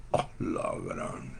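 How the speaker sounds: whispered, slow Guatemalan oh la gran; Guatemalan exclamation. Retold or imitated. Preacher voicing a Guatemalan's astonished oh la gran. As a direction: breathe it out in Guatemalan amazement. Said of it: "como los chapines ... decimos".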